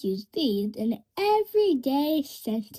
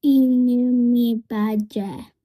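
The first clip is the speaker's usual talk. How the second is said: repeat together; 3 semitones lower, 7 dB louder than usual. Shown as a demonstration. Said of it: "Can you say it with me".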